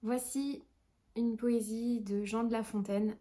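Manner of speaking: ordinary talk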